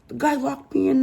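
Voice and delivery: mock whine. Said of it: "she literally says".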